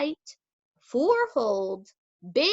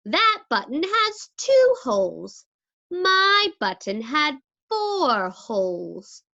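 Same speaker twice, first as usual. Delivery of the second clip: Toad objecting; 5 semitones higher, 4 dB louder than usual. A narrator's reading. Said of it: "said Toad".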